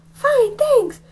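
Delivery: put-on high, timid boy's reply; high kid voice. Young Rafe answers the teacher in a high voice. Narrator voicing himself as child.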